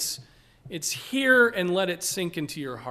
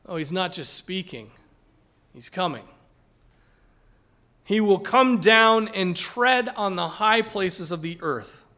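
louder, proclaiming reading of the verses; scripture read aloud; 2 semitones higher, 5 dB louder than usual. Acted. Pastor reading Micah to church, loud proclaiming. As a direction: let the mountains melt in your voice. Reads prophecy aloud to congregation.

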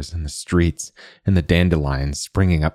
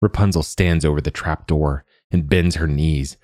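usual speaking voice

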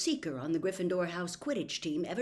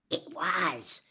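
ordinary delivery

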